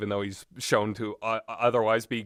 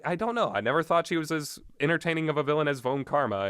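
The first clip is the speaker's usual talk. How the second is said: ordinary delivery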